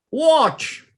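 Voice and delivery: high, commanding poem outcry; headmaster's scream. Acted. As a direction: cry it out like a scolding headmaster. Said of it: "screams our English headmaster".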